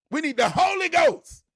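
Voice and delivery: high, loud preaching cry; preaching shout. Real, not acted. Preacher to saints, shouting. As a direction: lift it up like a sermon.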